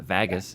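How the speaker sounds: VEGAS said wrongly with a short A, VAG-us; wrong pronunciation. Shown as a demonstration. Host correcting friend's word, showing wrong pronunciation. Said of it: "It's not Vegas".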